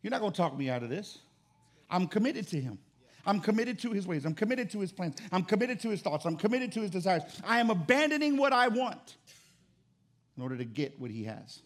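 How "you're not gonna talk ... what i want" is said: loud, high, building sermon cry; preaching at full force. Acted. Preacher crying out to the congregation, loud and building. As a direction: preach it out, climbing to a shout.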